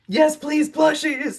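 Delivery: gushing plea. Retold or imitated. Fans begging the author for plushies, squealing high. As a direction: squeal it like a fan who must have them. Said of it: "people are like".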